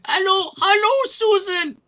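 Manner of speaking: piercing high falsetto greeting; hammed-up squeaky foreign caller; mocked telemarketer. Cold caller greeting Susan, high mocking falsetto. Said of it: "when I answer the phone".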